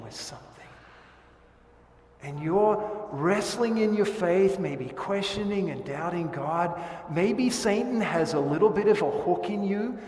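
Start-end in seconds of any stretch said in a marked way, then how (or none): 2.2-10.0 rising, louder pulpit cadence; earnest sermon build; preaching with force. Acted. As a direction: press on the listener's struggle.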